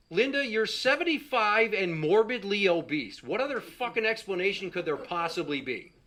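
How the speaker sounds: loud, exasperated rant at her; exasperated yell. Retold or imitated. Son yelling at his mom about her weight, exasperated. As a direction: blast it out in disbelief.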